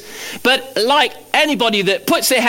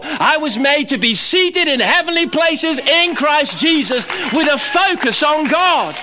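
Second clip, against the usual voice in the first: fervent preaching; 7 semitones higher than usual. Acted. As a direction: preach it out with rising fire, higher pitched. Preaching builds to full force.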